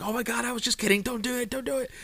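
very high, fast squeaky panic; imitating a frantic plea as the kaiju regrows; panicked pleading. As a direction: backpedal in a high-pitched panic. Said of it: "he's like".

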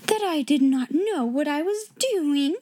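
high, affronted disbelief; Miss Minchin gasping. A narrator's reading. Headmistress stunned by the pupil's answer, incredulous gasp. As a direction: echo it back, scandalised and rising. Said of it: "Miss Minchin fairly gasped".

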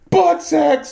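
playful shout. Retold or imitated. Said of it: "she always screamed when someone said butt sex".

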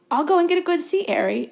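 put-on high, loud piping boyish call. A narrator's reading. Colin calls after Harry heading to the stands, piping high. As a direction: pipe it after him cheerfully. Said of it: "in a piping voice".

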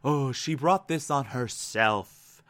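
low, soft, offhand jab; subtle dismissiveness. Retold or imitated. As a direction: drop it softly as a quiet dig. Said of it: "subtle F U thing".

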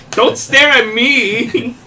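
high, loud and very drawn out; loud protest. Real, not acted. Friend teased during laughter, calls out.

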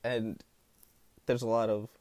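normal talking voice

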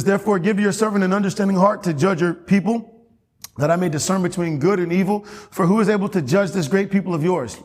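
lifted pulpit reading; scripture reading. Acted. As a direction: read the verse out like a preacher. Reads scripture aloud in preaching.

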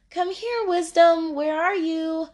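high-pitched mock seeking call; searching call. Seeker calling for wisdom in hide and seek, high-pitched searching call. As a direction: call out as if seeking someone hidden.

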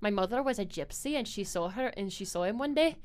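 storytelling in a put-on Scottish accent; lightly played Scottish brogue. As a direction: tell the family tale as a Scot. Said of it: "it's just, like, stuff like that".

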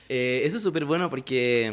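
ordinary talk, nothing marked

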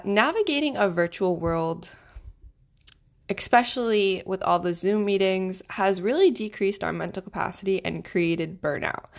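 usual speaking voice

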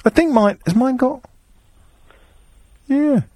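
usual speaking voice

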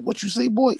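high-pitched incredulous demand; stern father's challenge. Retold or imitated. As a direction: confront the kid, voice shooting up. Retold father confronting his son.

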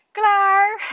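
put-on high, loud, drawn-out child's call; imitated kid's proud yell; loud shout. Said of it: "hij riep ook heel hard".

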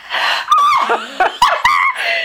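long, very high-pitched burst of laughter; helpless high cackle; high laughing shriek. Real, not acted. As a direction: crack up in a squealing laugh. Cracking up at sieve story.

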